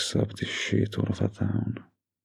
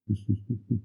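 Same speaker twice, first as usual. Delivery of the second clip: soft chuckle; 3 semitones higher, 5 dB quieter than usual. Acted. Boyfriend picturing their future children, soft chuckle. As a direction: chuckle quietly to yourself.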